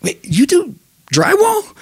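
high, astonished bartender; surprised. Acted. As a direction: shoot up in disbelief.

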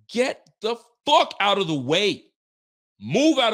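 high, loud, slow "get the fuck out of the way"; exasperated command. Real, not acted. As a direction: bellow it at players who should know better. Ranting about players near loose ball.

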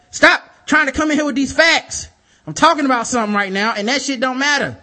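loud, fast, high-pitched rant; irritated rant. Retold or imitated. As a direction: shout them down mid-rant. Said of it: "It was like".

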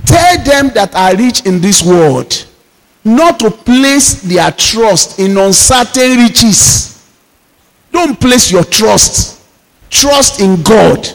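drawn-out exhortation, each word weighed; slow pulpit exhortation; slow preaching. Real, not acted. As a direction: plead with the congregation slowly.

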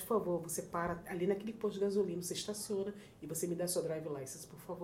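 low, quiet imitation of an official order; authoritative, retold. Woman orders driver to the gas station, quietly authoritative. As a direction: lightly play someone in charge. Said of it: "sabe, com autoridade".

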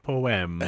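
"poem" echoed as a drawn-out po-em; Mister Simmons's pronunciation. Retold or imitated. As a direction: mimic "poem" in two stretched syllables, po-em. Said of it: "I'm pretty sure he did".

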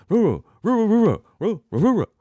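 high, loud roo-roo following the tune of a sentence; wordless booming voice, muffled; muffled wordless intonation. Shown as a demonstration. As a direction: hum out the sentence shape through a wall. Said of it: "you hear the intonation".

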